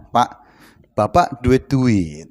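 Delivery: put-on soft, low child begging Dad; pitiful pleading. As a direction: drag it out in a small, pleading voice.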